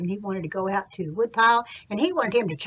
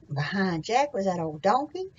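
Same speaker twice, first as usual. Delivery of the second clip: plain reading voice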